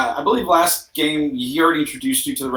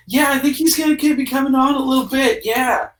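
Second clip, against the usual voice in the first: eager clubgoer; 11 semitones higher than usual. Acted. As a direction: hype up the DJ's arrival. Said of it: "somebody else is like".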